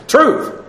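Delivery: TRUTH punched high as a standalone word; emphatic TRUTH. Shown as a demonstration. Preacher showing the Greek word order, stressing TRUTH emphatically. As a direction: say "Truth" high, as if in capital letters. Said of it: "capitalize all the letters".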